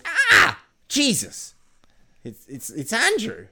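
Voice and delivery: high, loud yelp easing into stammer; startled shriek. Acted. Frightened prince discovering his uncle, mock shocked outburst. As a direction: cry out in hammed-up fright.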